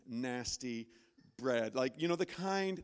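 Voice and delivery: ordinary talk, nothing marked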